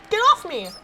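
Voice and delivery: high, loud, panicked command; alarmed shout. Acted. Witch has a mouse on the slipper, alarmed shouting. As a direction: yell as you shake off an attacker.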